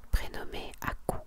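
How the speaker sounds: usual speaking voice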